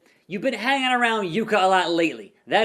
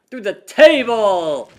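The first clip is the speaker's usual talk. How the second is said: loud excited table-spot call; roaring ringside call; announcer call; 3 semitones higher, 9 dB louder, slower than usual. Pretend. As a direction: yell it like a play-by-play man. Calling the big spot.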